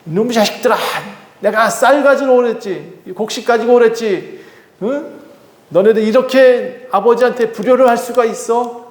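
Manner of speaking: a father berating his grown sons; angry father yelling. Acted. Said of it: "소리를 칩니다".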